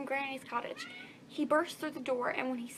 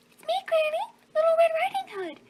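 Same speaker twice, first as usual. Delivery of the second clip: put-on little girl voice; 9 dB louder than usual.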